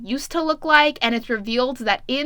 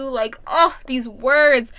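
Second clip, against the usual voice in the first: usual speaking voice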